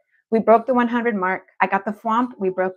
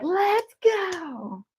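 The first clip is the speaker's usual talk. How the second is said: a much higher put-on voice saying let's go; lightly voiced spirit, eager push; Spirit's voice; 10 semitones higher than usual. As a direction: voice the spirit guide urging her on. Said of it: "Spirit's like".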